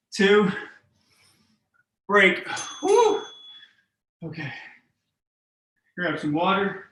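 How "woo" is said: relieved whoop. Real, not acted. Break after hard set.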